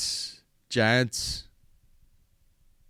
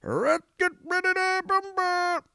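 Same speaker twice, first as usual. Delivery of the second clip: high, slow drawn-out rumble call; hammed-up arena announcer; ring announcer call; slower than usual. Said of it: "gone back to fucking".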